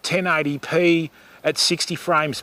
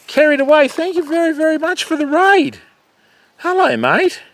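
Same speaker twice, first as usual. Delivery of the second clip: high, louder, beaming thanks for the raid; excited thanks; 11 semitones higher, 4 dB louder than usual. Real, not acted. Thanking Carried Away for the raid, high and excited. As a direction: gush your thanks up high.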